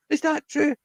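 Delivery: very high mock-gullible kid voice; Max, credulous. Boy turning around to ask her, high mock-gullible. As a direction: put on a high, believing child's question. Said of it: "had Max turning around and saying".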